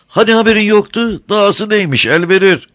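high, sweet-talking crafty smuggler; sly, sugary old rogue; honeyed voice. A narrator's reading. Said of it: "tatlı konuşuyor".

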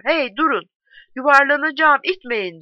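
high, urgent protest; calling out in alarm. A narrator's reading. Bruno about to fall, telling men not to push, calling out. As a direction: raise the voice to stop them.

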